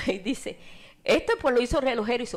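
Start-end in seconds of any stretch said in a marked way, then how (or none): 1.0-2.2 high, loud confident answer; soldier's loud reply to the boy; loud retort. Retold or imitated. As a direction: toss the answer back boldly.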